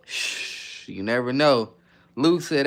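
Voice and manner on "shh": playful shush. Pretend. Hushing about FRT question.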